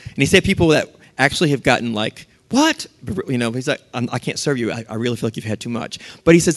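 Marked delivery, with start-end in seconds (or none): 2.5-2.9 high-pitched incredulous "what"; mock indignant. Passenger cut off on the airplane, mock indignation. Drunk passenger refused a drink. 3.9-6.0 low, soft and hurried apology; gentle firm refusal. Acted. Flight attendant cutting off a passenger, quiet firm refusal.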